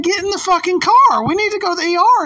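high, urgent retold command; worked-up and insistent, re-enacted; exasperated order. Retells ordering him into the car.

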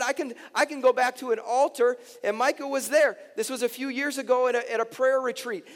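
loud, high-pitched testimony; full-force preaching. Acted. Pastor recalling a prayer retreat to the congregation, full-force preaching. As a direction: drive the story out over the room.

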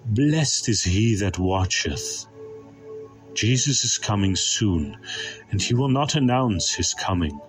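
ordinary delivery

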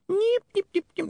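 very high repeated nips; tiny high-pitched imp chirps; fast-forward squeaks. Acted. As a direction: squeak like a skipping tape. Imp imitates fast forward noise.

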